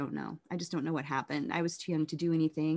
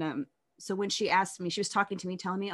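normal talking voice

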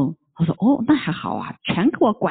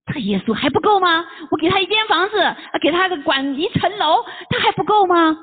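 higher, indignant grumbling; annoyed complaint; 10 semitones higher than usual. Acted. The young man grumbles about Jesus, higher and indignant. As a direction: puff up with hurt pride, much higher. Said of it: "这回这个小年轻就有点生气了".

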